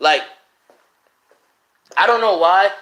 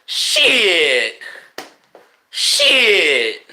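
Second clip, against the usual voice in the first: voice cracking; much slower than usual. Real, not acted. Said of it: "I don't care if my voice cracks".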